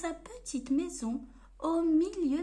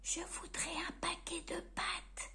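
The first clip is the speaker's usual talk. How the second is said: much softer timid whisper; breathy shy request; 4 semitones higher, 11 dB quieter than usual. Acted. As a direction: ask the grocer in a frightened whisper. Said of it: "murmurait Monsieur Silence".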